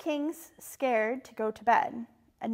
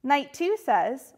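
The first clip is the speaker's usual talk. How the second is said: plain reading voice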